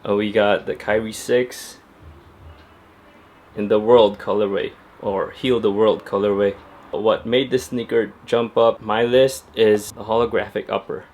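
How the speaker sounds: ordinary delivery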